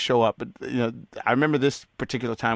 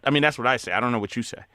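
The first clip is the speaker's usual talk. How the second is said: ordinary talk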